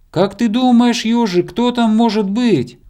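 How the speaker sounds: high, loud wondering question; curious bear cub. A narrator's reading. Bear cub asking hedgehog who hangs there, high loud voice. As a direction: ask loudly in a high voice.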